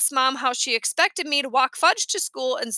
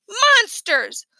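put-on high frightened cry of a little boy; scared child; 8 semitones higher, 4 dB louder than usual. A narrator's reading. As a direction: blurt it out like a scared kid. Scared child voiced in story.

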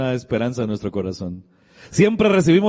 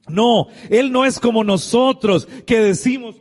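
raised, high, emphatic sermon voice; fervent preaching; 7 semitones higher than usual. Acted. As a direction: preach it out to the congregation. Preacher rises to full force.

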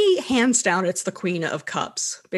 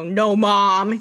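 loud bratty no; bratty kid; 5 dB louder than usual. Retold or imitated. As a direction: talk back to mom loudly. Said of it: "type of thing".

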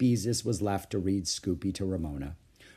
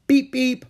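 high, loud horn imitation; honking; 13 semitones higher, 9 dB louder than usual. A narrator's reading. Ramona playing trolley bus as sister reads, honking loudly. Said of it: "honked Ramona".

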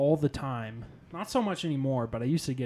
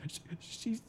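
mock tearful stammer, breaking into a laugh; a badly faked sob that dissolves into giggles; fake tearful; 2 semitones higher than usual. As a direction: pretend to choke up but crack up laughing. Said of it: "pretending like I was tearing up".

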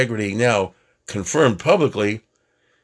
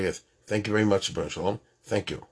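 soft, murmured thanks to God; quiet heartfelt thanks; 4 semitones lower, 7 dB quieter, faster than usual. Retold or imitated. A grateful person quietly thanking God, soft and murmured. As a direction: hush it like a private prayer. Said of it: "even a little bit firm will say".

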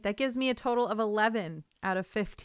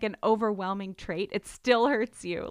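ordinary talk

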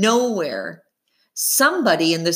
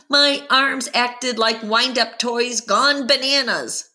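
usual speaking voice

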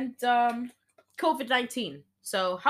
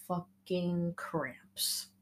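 slow breathy whisper; whispered curse; 8 dB quieter, much slower than usual. Retold or imitated. Anger cursing the cramps in a slow whisper. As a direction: hiss it out in slow pain.

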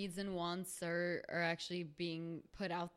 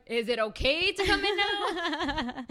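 sheepish pleading; 8 semitones higher, 10 dB louder, slower than usual. Retold or imitated. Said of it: "I'm like".

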